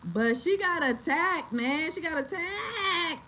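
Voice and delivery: high, drawn-out plaintive cry; upset wail. Real, not acted. Owner lamenting her attacked dog to viewers, high upset wail. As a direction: wail it out, stretching the last word.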